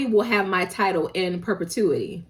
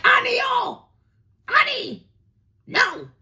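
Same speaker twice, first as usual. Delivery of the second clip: mock refusal shout; slower than usual. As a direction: yell the refusal like a stubborn child. Character refusing loudly.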